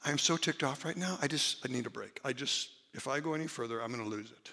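quietly asking for time out before it boils over; calling a break. Pretend. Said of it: "Role playing".

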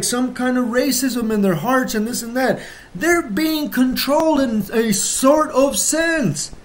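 normal talking voice

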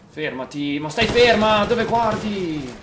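high, loud, slow scolding; angry shout. Real, not acted. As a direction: yell at her to stop. Yelling at teammate.

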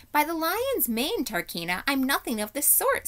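high-pitched, affronted protest; indignant. A narrator's reading. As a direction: deny it with offended dignity. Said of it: "said Bree indignantly".

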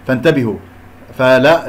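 normal talking voice

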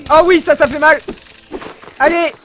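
ordinary talk

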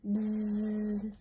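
sustained buzzing bee noise; imitated swarm of bees. A narrator's reading. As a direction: hold a long, level bzzz.

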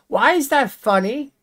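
high, rising, incredulous why; irritated question. Real, not acted. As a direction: ask it in disbelief, pitch going up at the end. Annoyed at viewer's laugh.

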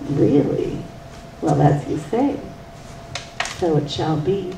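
low, solemn wise woman. Acted. Old woman becomes wise woman.

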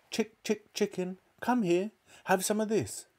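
high, light sing-out to an animal; coaxing. A narrator's reading. George calling the hen to the spoon, coaxing.

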